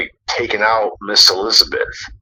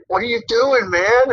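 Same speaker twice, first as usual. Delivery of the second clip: retold yell; 13 semitones higher than usual. Retelling Brutus yelling at Macho Man, high mock yell. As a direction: imitate an alarmed yell in a raised pitch. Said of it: "just yelled at him".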